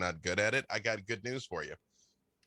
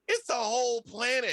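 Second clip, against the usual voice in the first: high, louder playful protest; mock exasperated retort; 14 semitones higher, 5 dB louder than usual. Replying to viewers questioning an accent, mock exasperated.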